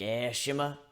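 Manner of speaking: plain reading voice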